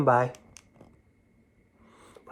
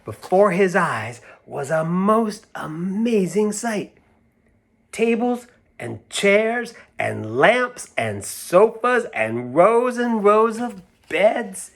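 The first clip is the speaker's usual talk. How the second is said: slow, higher and louder with wonder; awed narration; 9 semitones higher, 5 dB louder, slower than usual. A narrator's reading. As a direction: unveil an amazing sight, slowly. Awed story build.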